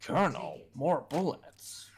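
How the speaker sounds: slow, purring soldier voice; Solid Snake voice. Acted. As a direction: purr it out slowly like a cat. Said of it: "Solid Snake impression like he's, like, a cat purring".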